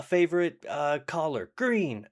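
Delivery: high-pitched, eager quick-fire exchange; Step Brothers joke. Retold or imitated. Acting out two doubles doing the Step Brothers joke, excited. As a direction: blurt question and answer in a high, excited voice. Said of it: "the Step Brothers joke".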